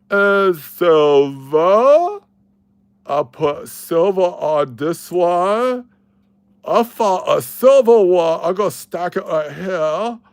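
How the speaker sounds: high put-on voice of a hearing-impaired school friend, drawn out and slow; Billy voice. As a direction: imitate old friend Billy, high and halting. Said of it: "so that's actually how I sounded".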